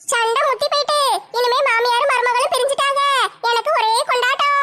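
delighted busybody savouring the quarrel; sly neighbour's gleeful aside; scheming gossip neighbour. Acted. Gossip neighbour gloats over fight.

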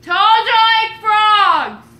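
high, loud repeat. Real, not acted. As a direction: call it out so it gets through.

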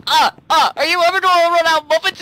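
high, loud, rushed cartoon impression; imitated cartoon character. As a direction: mimic a cartoon character pestering loudly and fast. Acted quote from cartoon skit.

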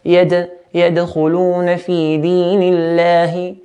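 measured recitation with a long hummed noon and held vowels; Quran recitation. Shown as a demonstration. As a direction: recite with clear a, held n, bounced d. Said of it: "So nothing between".

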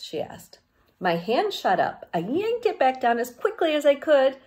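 plain reading voice

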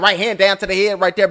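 normal talking voice

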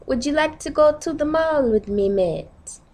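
high UK-accent question, falling on "mate". Shown as a demonstration. Asking a mate along to the mall, UK accent. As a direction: ask it in a put-on, sing-song UK accent. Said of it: "So U K accent".

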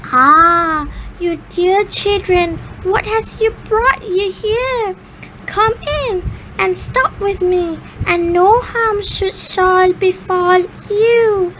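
put-on high, falsely kind old woman; sweet old witch. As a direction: coax the children in sweetly. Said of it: "the old woman, nodding her head and said".

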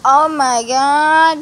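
loud, drawn-out slow cry of pain; retelling a dog bite with a loud slow groan; pained exclamation. Retold or imitated. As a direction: stretch it out like the bite really hurt. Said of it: "I was just like".

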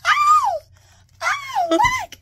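very high surprised squeal; delighted shriek. Real, not acted.

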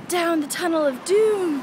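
loud, slow, mock dramatic; ominous announcement. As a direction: announce it like a movie trailer.

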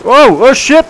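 high, loud startled shout; sudden alarm as it goes wrong; shocked yell. Real, not acted. Kid startled when his tree hits a car, shocked yell.

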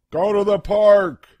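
high, loud, eager blurt; eager grandma. Acted. Grandma pushes for the park, loud and high. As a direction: jump in with the park idea.

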